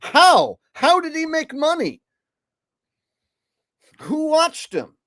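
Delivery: high, loud disbelief; ranting in high-pitched disbelief; incredulous. Real, not acted. Incredulous at the TikToker.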